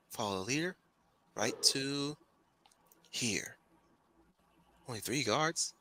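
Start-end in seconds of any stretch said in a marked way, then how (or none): none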